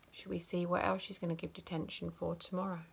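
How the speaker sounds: plain reading voice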